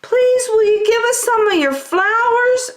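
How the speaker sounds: very high pleading cub voices; tiny polite wolves; little wolves asking. A narrator's reading. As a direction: ask sweetly and high. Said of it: "asked the little wolves".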